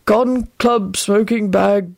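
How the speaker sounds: dazed, disjointed mumble; mumbling. A narrator's reading. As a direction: ramble woozily in fragments. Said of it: "Neville mumbled".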